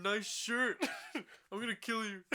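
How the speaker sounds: high, silly pretend threat; mock threat. Co-host parodying the knife guy to his friend, high mock threat.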